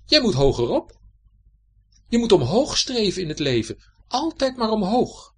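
a boastful lizard soaring to a squeak on altijd maar omhoog; bragging lizard cousin. A narrator's reading. Lizard cousin lecturing the salamander, pitch rising to a squeak.

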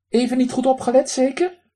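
high, loud duck voice with a raspy quack. Acted. Drake lectures the man who threw pebbles, high raspy quack. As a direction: play a cheeky drake scolding a stranger.

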